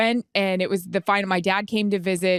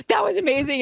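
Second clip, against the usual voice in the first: gushing sister; 10 semitones higher, faster than usual. Retold or imitated. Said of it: "And Kendra's like".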